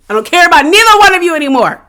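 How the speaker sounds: high, fast, heated dismissal; fed-up outburst. Real, not acted. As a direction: blurt it out, completely fed up. Repeats dismissal, escalating.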